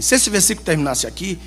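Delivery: ordinary delivery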